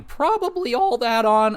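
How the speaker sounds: high-pitched cartoon nerd voice; nerdy kid from The Simpsons. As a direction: put on a squeaky nerd-kid voice. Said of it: "nerdy kid from The Simpsons".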